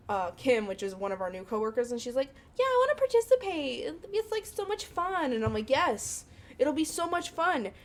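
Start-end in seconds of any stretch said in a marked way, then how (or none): 2.6-5.3 very high, gushing imitation of enthusiasm; squeaky mock excitement; eager coworker. Imitating a keen new coworker. 5.7-7.7 high, drawn-out pep talk; acted-out enthusiastic urging; rallying a coworker.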